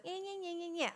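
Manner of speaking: high-pitched bratty mimicry of a child; mocking. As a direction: imitate a five-year-old mocking his mother. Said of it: "he was, like, mocking me".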